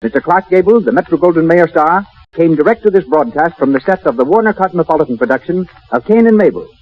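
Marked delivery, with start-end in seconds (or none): none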